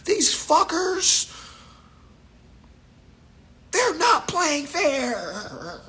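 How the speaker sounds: high, whiny mock voice, slowing to a drawn-out laugh; mock complainer. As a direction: mimic the griping crowd high and mocking.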